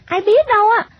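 put-on high-pitched child's retort; little girl brushing off. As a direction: dismiss the boy in a high young voice. Girl shrugs off boy, wants home.

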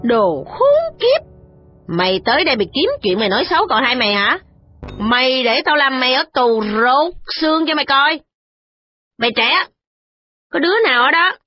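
furious threats. A narrator's reading. The matron threatening the servant woman, furious. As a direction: threaten her, building to a cry of insult. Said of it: "điểm mặt Thị Tố mà nói rằng".

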